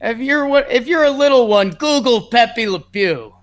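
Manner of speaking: high-pitched imitation, slowing at the end; Paul O'Neill. Broadcaster explaining a cartoon to young viewers, high-pitched impression. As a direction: play a hammed-up commentator, voice pitched high. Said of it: "he's just got some good Paul lingo".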